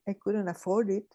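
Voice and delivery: ordinary talk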